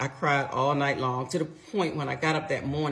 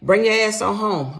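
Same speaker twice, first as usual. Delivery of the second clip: furious; 5 semitones higher, 6 dB louder than usual. Retold or imitated. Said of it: "I'm furious".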